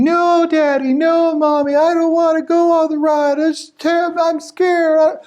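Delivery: put-on high, whining child's wail; crying scared kid. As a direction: wail like a terrified little kid. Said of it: "crying, bawling".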